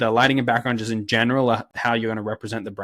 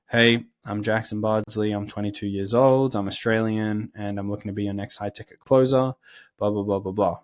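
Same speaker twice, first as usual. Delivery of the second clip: low energy monotone. Shown as a demonstration. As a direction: drag through it like you'd rather be anywhere else. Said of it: "really low energy and really monotone".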